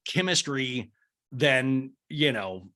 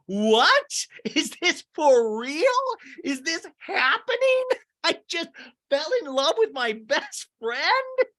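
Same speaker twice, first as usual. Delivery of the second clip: very high, drawn-out mock disbelief; hammed-up giddy amazement; [name], very amped up; 4 dB louder, slower than usual. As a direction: squeal it like an overjoyed girlfriend. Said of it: "She's very amped up".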